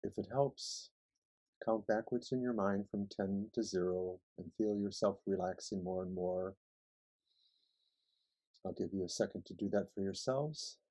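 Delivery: soft, calm meditation voice; guided meditation. Acted. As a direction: ease them into relaxing. Leads a relaxation meditation.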